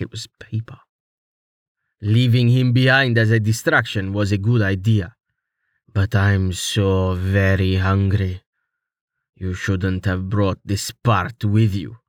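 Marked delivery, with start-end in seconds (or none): 2.0-12.0 menacing villain. A narrator's reading. Monster villain taunting, hungry.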